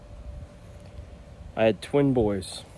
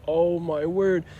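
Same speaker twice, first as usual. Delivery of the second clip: exasperated; 7 semitones higher, slower than usual. Retold or imitated. Exhausted dad, second baby screaming.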